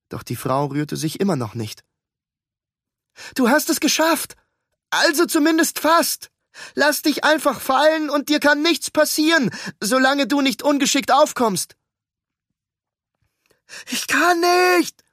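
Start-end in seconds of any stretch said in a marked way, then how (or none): 3.3-11.8 loud, high encouraging call. Acted. Ruben calls up to woman. 13.9-15.0 high, loud panicked cry; frightened cry. Acted. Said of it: "rief die Frau".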